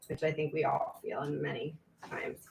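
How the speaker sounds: whispered aside. Real, not acted. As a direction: drop to a whisper for a private comment. Whispered aside while reading aloud.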